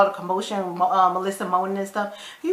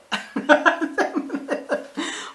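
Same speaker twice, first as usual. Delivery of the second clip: breathy laugh; 6 semitones higher, 3 dB quieter than usual. Real, not acted. Laughing at insult.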